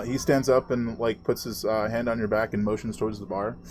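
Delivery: ordinary talk, nothing marked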